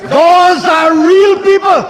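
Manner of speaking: jab each word at the opposition; indignant accusation. Real, not acted. Minister rebuking opposition members across the house, indignant shout.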